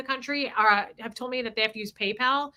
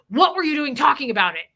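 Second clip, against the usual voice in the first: higher, louder panicked demand; imitated freak-out; 4 semitones higher, 6 dB louder than usual. Teller imitates Jeff in the car, freaking out. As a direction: act out his alarmed reproach. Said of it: "he was freaking out".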